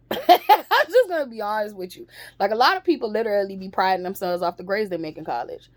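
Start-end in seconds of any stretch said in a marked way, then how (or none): 0.1-2.1 laughing through the words. Real, not acted. Admitting her opinion of college to viewers, laughing. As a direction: laugh it out, higher.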